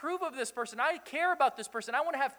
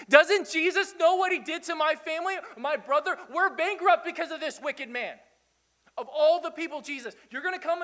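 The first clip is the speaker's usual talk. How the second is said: indignant townsperson; 6 semitones higher, 5 dB louder than usual. Acted. Grumbling crowd voiced with laughs.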